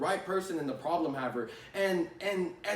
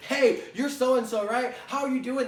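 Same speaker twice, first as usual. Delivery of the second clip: overeager caller; 5 semitones higher, 5 dB louder than usual. Retold or imitated. Mocking a rookie rep who jumps at the customer, loud and too eager. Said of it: "You get excited".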